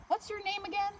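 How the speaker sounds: a lightly voiced manager asking. Manager asks Mary her name, lightly voiced. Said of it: "the manager asks".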